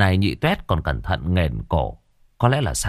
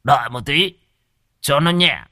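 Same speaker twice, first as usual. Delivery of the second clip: put-on high, easygoing drunk mumble; relieved drunk voice; lightly voiced old drunk; tipsy old man; 6 semitones higher than usual.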